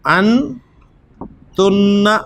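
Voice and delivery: अंतुन्ना stretched very slowly with a long doubled n: an-tun-naa; slow model pronunciation. Shown as a demonstration. Teacher modelling Arabic pronoun for students, very slow. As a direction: draw out अंतुन्ना, holding the double n. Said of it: "अंतुन्ना, (teacher models the pronoun)".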